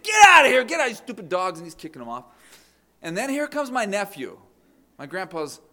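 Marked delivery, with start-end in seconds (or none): 0.0-1.6 high, loud shout at the dogs; yelling at dogs. Retold or imitated. Grandpa shouting the dogs off his cement, loud yell. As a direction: bark them off the wet concrete.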